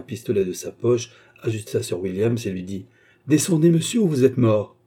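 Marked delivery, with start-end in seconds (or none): 3.3-4.8 loud, raised-pitch command at gunpoint; forceful ultimatum; threatening order. A narrator's reading. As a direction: order him down, meaning the threat. Pistol drawn, threatening order.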